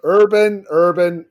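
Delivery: loud, playful scolding of a name; mock exasperation. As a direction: call out the name loudly in mock dismay. Mock exasperated calling of coach's name.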